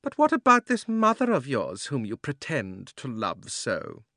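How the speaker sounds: cold, commanding witch; a scheming sorceress speaking. A narrator's reading. Witch taunts.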